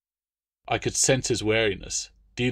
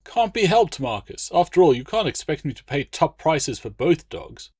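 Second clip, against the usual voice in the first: a hint of a pitched-up, hearty noble; jovial legate; 6 semitones higher than usual. A narrator's reading. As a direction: cheerfully push the deal through. Legate teasing friend.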